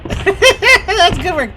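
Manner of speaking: slow, drawn-out words through laughter; laughing. Real, not acted. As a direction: laugh it out slowly. Laughing at the soldier's blunder.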